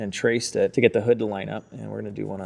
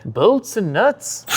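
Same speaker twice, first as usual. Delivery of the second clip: put-on Irish, higher and drawn out; mock Irish accent; 9 semitones higher, 5 dB louder, much slower than usual. Joker playing an Irish hardware store clerk, mock Irish accent. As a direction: stretch it out in a playful Irish accent. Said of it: "That's my Irish accent".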